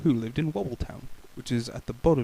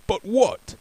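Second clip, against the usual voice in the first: higher puzzled question; puzzled butcher; 8 semitones higher, 5 dB louder than usual. Acted. Butcher asking the baker, puzzled. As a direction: ask it baffled. Mister Bacon asks.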